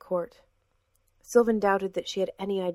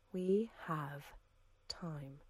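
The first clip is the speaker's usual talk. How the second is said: much softer, trailing into silence; fading hush; 3 semitones lower, 11 dB quieter, much slower than usual. A narrator's reading. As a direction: let "We have time" die away. Woman repeats calmly.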